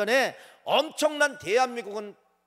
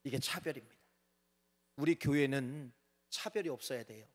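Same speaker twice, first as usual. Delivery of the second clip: quiet; 5 semitones lower, 9 dB quieter than usual. Real, not acted. Drops voice after mock quote.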